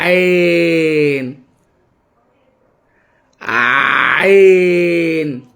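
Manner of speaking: عين stretched very long and slow; عين held long and low, over-articulated; drawn-out word. Shown as a demonstration. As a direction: draw out عين for the child to copy. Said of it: "نطق كلمة من مقطع واحد".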